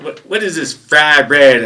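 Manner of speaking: slow, loud puzzled bark; drill sergeant voice. Retold or imitated. Drill sergeant puzzled by fry bread, slow loud bark. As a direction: bark out the strange word slowly. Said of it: "That's how he said".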